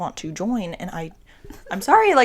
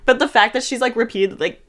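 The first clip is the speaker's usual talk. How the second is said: ordinary delivery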